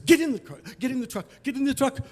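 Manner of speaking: high, rapid-fire urging; urgent order. Retold or imitated. Tow truck driver hurries victim away, urgent order.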